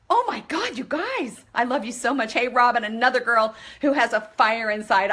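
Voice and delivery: normal talking voice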